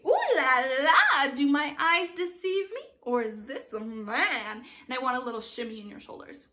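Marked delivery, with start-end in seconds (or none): 0.1-4.7 loud, drawn-out, swooping ooh la la; acted stage line. Shown as a demonstration. Director shows Babette her line about spotting a man, theatrical and drawn out. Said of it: "and say".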